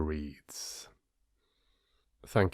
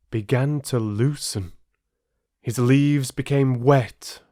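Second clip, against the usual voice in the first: building, tense fable narration; storyteller describing a hurricane to listeners; story narration; 3 semitones higher than usual. A narrator's reading. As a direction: tell the storm with rising tension. Storm part of fable.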